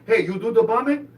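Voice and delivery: high, loud chipper question; news caller questioning. Retold or imitated. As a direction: imitate a casual phone interviewer. News caller asks the bomber.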